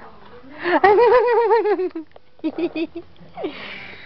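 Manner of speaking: long happy giggle; giggly tickle attack. Real, not acted. Laughing while playing with baby.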